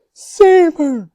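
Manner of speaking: high, loud panicked scream. A narrator's reading. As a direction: scream for your daughter. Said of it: "screamed Missus Salt".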